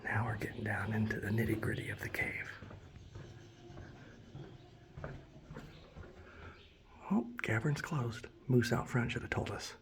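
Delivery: soft unvoiced whisper. Real, not acted. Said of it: "I have to whisper".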